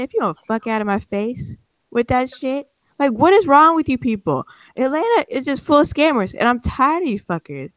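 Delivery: high, exasperated rant; retold angry telling-off. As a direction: tell him off, fed up. Telling off the scammer.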